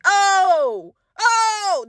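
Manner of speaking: high, laughing panicked oh, oh; panicked oh noises. Retold or imitated. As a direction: squeal oh in flustered panic. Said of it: "that's literally what I'm doing".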